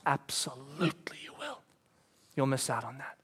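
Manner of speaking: hushed, breathy whisper of certainty. Real, not acted. Preacher whispers the warning to his congregation.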